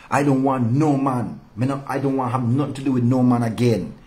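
normal talking voice

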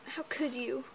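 ordinary talk

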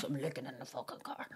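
very quiet, low retold threat; low stern reply. Policeman in a joke overrules suspect, low and quiet. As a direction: drop right down, softly menacing.

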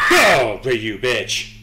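loud snap at the monster; shouted curse. Real, not acted. Streamer shouting at the monster to get away. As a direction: yell at it to get moving.

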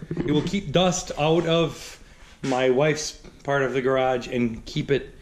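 ordinary talk

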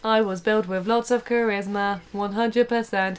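soft robot monotone with mechanical laugh. Pretend. Robot boasting of built-in charisma, monotone robot voice. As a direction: boast evenly, laugh syllable by syllable.

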